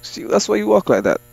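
high, loud furious yelling; angry yelling. Real, not acted. Gamer yelling at a threatening customer to back off. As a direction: shout him out of your face.

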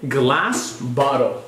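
slow, clear teacher modelling; careful slow demo of a word; slow model word. Shown as a demonstration. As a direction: say it slowly for the child to copy. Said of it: "G L A S S".

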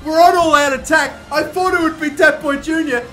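high, loud screaming celebration with laughs; ecstatic shout. Real, not acted. Gamer screaming with joy as his side scores in extra time.

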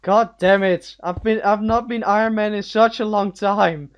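loud, exasperated groaning complaint; frustrated shout. Real, not acted. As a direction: yell it out in playful frustration. Loses Iron Man status.

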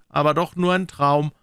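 ordinary delivery

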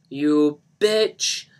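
loud, drawn-out insult; angry scream. Acted. Johnny rages at absent Lisa, screaming angrily. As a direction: hurl the insult in rage. Rampage after betrayal in story.

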